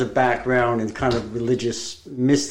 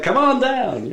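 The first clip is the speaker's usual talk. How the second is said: high, laughing game show call; game show host; 10 semitones higher, slower than usual. Pretend. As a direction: announce it like a jolly host. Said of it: "He was like".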